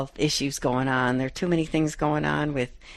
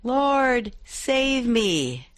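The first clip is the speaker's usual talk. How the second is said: crying out; 6 semitones higher, much slower than usual. Acted. Peter sinking in the storm cries out to Jesus, desperate. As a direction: call out as if sinking. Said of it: "he cried out".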